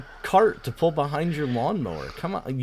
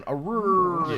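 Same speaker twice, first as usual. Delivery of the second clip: dog growl; 7 semitones higher, 3 dB louder than usual. Retold or imitated. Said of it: "her going".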